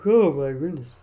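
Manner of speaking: talking through a big real yawn. Real, not acted. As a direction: stretch the words out in a yawn. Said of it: "A little bit of a yawning there".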